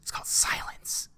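soft, mostly whispered threat; menacing whisper. Retold or imitated. Heroine pinning the partygoer down, whispered threat. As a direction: hush him like a predator over its prey. Quoting heroine after the fight.

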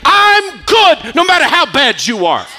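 fiery sermon climax, voice ringing; preaching at full force. Acted. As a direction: cry it out over the congregation. Preacher building to God's promise.

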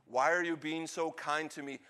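normal talking voice

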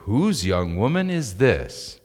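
slow, swooping, taken with her; intrigued question. Acted. Boaz asking his foreman about a young woman who caught his eye, slow and intrigued. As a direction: ask it as if she caught your eye. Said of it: "this takes a little bit of interpretation".

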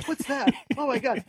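high-pitched, hammed-up fright; mock terrified dog. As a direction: voice a cowardly dog alarmed by nothing. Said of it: "She's terrified of everything".

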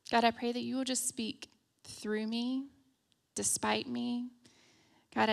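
soft, unhurried prayer. Real, not acted. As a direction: pray gently, pausing between phrases. Leads an opening prayer.

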